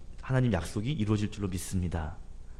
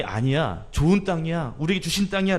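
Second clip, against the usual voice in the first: insistent protest; 6 semitones higher, 3 dB louder than usual. Retold or imitated. Two faithful spies protest loudly.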